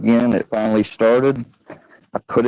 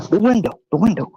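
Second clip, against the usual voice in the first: higher, hurried repeat; urgent pointing; 10 semitones higher, faster than usual. Retold or imitated. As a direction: point him out fast. Friend pointing escape route, urgent.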